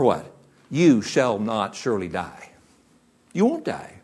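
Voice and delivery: a hint of a sneering devil; lightly voiced lying serpent. Preacher voicing the serpent's lie to the congregation, lightly sneering. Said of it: "with a bold-faced lie".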